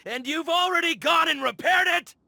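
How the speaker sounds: angry shout. Acted. Hoarder leader yelling at the repairers, furious. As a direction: yell at them in outrage.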